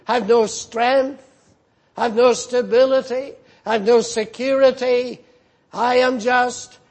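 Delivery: ordinary talk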